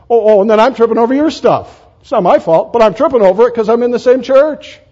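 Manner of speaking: high, loud mock protest; mock complaint. Acted complaint about tripping over junk.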